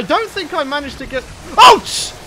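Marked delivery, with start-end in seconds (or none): 1.6-2.1 sharp high yelp of pain; a startled, real ouch. Real, not acted. Startled by a creature, a sharp yelp. Sudden hurt in game.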